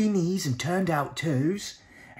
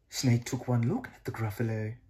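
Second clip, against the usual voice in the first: plain reading voice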